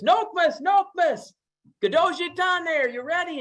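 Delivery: high calling shout; yelling for grandma. Acted. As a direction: call out to her from far off.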